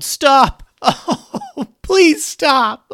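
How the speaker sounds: very high, loud panicked begging, fading at the end; yelled pleading. A narrator's reading. Bones surrendering to the approaching elephants, yelling in panic. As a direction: beg for mercy in a loud squeal, then tail off. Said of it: "held up his hands and yelled".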